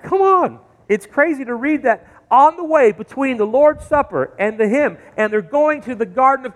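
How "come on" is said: exasperated. Retold or imitated. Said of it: "I read this and I'm like".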